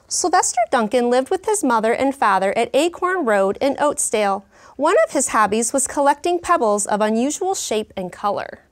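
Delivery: lively, expressive storybook reading; reading to children. Acted. Teacher reading a picture book to her class, lively storytelling. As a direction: bring the story alive for the class.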